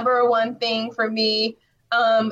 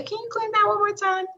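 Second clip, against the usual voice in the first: high, polite asking voice; polite customer request; 8 semitones higher than usual. Acted. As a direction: ask it as a customer would, pitched up. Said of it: "it's okay to say".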